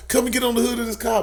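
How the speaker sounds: high, brisk police order; high-pitched imitation of an officer's command; imitated cop. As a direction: rattle off a cop's instruction. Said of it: "I hear the cop like".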